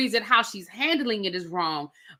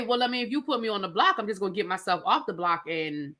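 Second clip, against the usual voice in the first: rushed retold quote; 6 semitones lower, 4 dB quieter, faster than usual. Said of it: "He did say".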